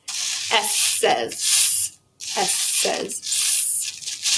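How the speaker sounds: slow hissing s, whispered; drawn-out s like a snake. Shown as a demonstration. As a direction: stretch the s into a long hiss. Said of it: "My gosh, I sound like a snake".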